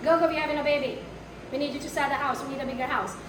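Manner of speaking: ordinary talk, nothing marked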